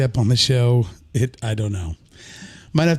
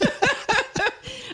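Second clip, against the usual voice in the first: high squealing laugh; high burst of laughter; 7 dB quieter than usual. Real, not acted. Big laugh after the quote.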